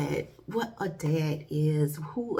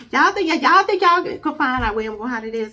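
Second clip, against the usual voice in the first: louder, playful taunting of the viewers; teasing; 5 semitones higher, 10 dB louder than usual. Acted. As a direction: dare them to find it, loud and cocky. Teasing audience about hiding place.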